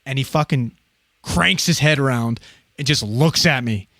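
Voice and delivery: usual speaking voice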